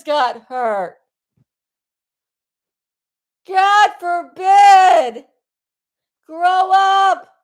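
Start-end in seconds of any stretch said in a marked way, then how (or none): 3.5-5.2 anguished cry. Real, not acted. Exasperated outburst on live stream. 6.3-7.3 loud, higher scolding shout; scolding yell. Real, not acted. Streamer telling grown men to grow up, yelling. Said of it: "Am I yelling?".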